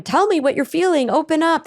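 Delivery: high, insistent pressing; pushy demand. Retold or imitated. Partner pressuring someone to open up, high and insistent. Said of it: "So when you tell someone".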